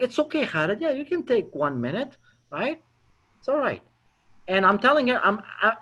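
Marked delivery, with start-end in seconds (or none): 0.0-3.8 higher, softer, soothing reassurance; reassuring rescue. Retold or imitated. Prospect rescues the nervous caller, softly reassuring. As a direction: let them off the hook gently. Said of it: "feel compelled to rescue me".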